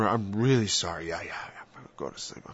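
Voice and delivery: fading to a sleepy whisper; sleepy mock sorry. Mocking the sinner nodding off, fading whisper. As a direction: drift off mid-apology.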